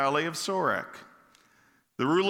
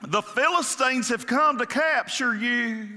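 put-on southern accent; 7 semitones higher, 3 dB louder than usual. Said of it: "in the southern accent".

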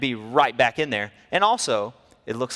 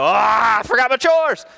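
much higher, loud startled cry; panicked realization; 10 semitones higher, 9 dB louder than usual. Retold or imitated. Boy spotting mom's car, alarmed and loud. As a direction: gasp it out as panic hits.

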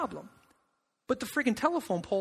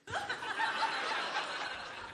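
high burst of laughter; 10 dB quieter than usual. Real, not acted. Pastor laughing at his son's blunt answer, high burst of laughter.

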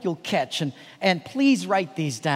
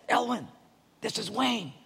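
retold whisper; 4 semitones higher, 5 dB quieter than usual. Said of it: "Why you're whispering?".

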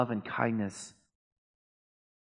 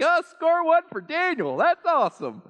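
much higher and louder hammed-up gloating; mock self-congratulation; 6 dB louder than usual. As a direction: crow over your own cleverness, tongue in cheek. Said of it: "And I'm like".

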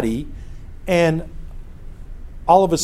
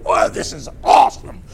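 put-on breathless, higher whisper of amazed kids; awed kids; 11 semitones higher than usual. Sixth graders marvelling at vultures, awed high whisper. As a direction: gasp it out like a thrilled sixth grader. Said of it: "They're just going".

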